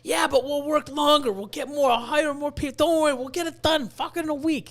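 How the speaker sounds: high, eager retold argument; pushy mock optimism; insistent persuading. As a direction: plead the case high and urgent, imitating himself. Acts out his insistent arguing for plan.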